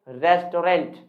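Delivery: "रेस्टोरेंट" in three parts, res-to-rent; common mispronunciation. Shown as a demonstration. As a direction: sound a full "to" and "rent" in "रेस्टोरेंट". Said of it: "जिसे हम कहते हैं क्या". ("रेस्टोरेंट" को रेस-टो-रेंट बोलें)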